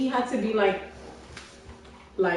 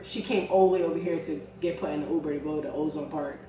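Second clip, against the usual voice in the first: ordinary delivery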